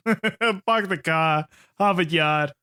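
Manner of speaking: slow, hammed-up Boston accent. Co-host showing how a Bostonian talks, in a thick Boston accent. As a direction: draw out a stock Boston accent, dropping the r's. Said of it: "He's Bostonian".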